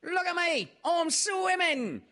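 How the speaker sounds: very high, excited squeak of a little kangaroo; squeaky Roo. A narrator's reading. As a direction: squeal it out like a proud little joey.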